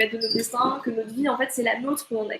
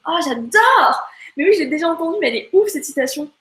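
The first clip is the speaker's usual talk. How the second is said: delighted; 5 semitones higher, 7 dB louder than usual. Real, not acted. Delighted reaction to a quote.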